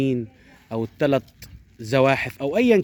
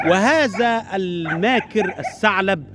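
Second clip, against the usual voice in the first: laughing; 6 semitones higher, much slower than usual. A narrator's reading. Laughing at the sly fox.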